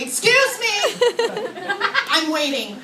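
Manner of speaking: high, indignant call; mock window shout. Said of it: "I shout out my window".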